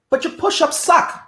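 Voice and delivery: light mock coach ribbing; teasing coach. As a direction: pitch jumps up on the jab. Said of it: "He literally used to say to me".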